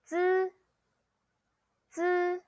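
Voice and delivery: "zi" on a high, level, held tone; pronunciation model. Shown as a demonstration. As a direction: say "zi" as a buzzed dz, high and even. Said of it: "This one you say".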